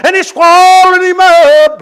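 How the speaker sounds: high, loud, sung-out preacher's cry; preaching whoop. Acted. Preacher to his congregation, telling of the whale, in a high chanted whoop.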